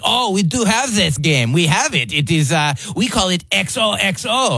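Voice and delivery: high, hammed-up French accent; French guy proudly explaining, mock accent. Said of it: "these French guys were like".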